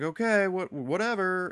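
lightly voiced teenager, pitched high; imitated dismissive teenager. Voicing teenage son's dismissive reply.

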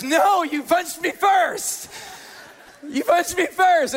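put-on high, loud, drawn-out kid accusation; mock indignant yell. Boy rejects his brother's apology, mock outraged yell. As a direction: play a child flatly refusing to forgive, hammed-up.

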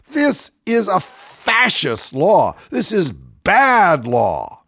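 very slow, emphatic denunciation; indignant outburst. Real, not acted. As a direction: drag out each word in indignation. Indignant comment on news item.

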